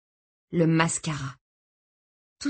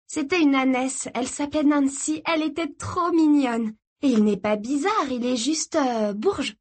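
put-on higher-pitched girl defending a friend; eager girl; 7 semitones higher than usual. A narrator's reading. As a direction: gush in a high girlish voice. Girl defends her new friend.

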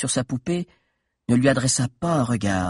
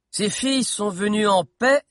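higher raised call; crying out; 9 semitones higher than usual. Acted. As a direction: call down from the balcony. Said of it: "cria Ogenor".

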